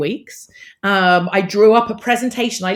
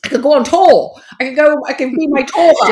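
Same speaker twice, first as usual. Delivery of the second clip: excited; 7 semitones higher, 7 dB louder than usual. Retold or imitated. Dreaming up the bus plan.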